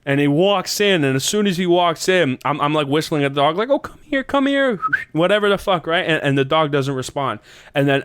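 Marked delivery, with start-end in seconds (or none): 3.7-4.8 high, coaxing pet-call; calling the dog. Retold or imitated. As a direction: coax a dog over in a high voice.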